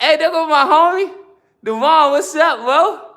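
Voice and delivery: high, loud greeting to a homie; calling out. Real, not acted. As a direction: hail a friend across the way. Breaks off to greet a friend.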